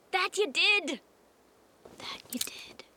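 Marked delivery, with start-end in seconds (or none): 2.0-2.8 whispered, fading last words; dying whisper. Acted. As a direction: breathe it out like a last breath. Dying echo of same words.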